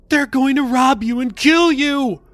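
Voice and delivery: high, loud demonic yell; shrill mocking demon shout. A narrator's reading. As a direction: screech it like a taunting demon. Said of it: "the demons yelled in her ears".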